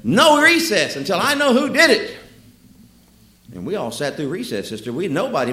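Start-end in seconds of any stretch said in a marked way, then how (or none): 0.0-2.2 high, loud, slow stern decree; teacher punishing the silent class, loud and high; angry teacher. Retold or imitated. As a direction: lay down the punishment loudly. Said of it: "finally she said".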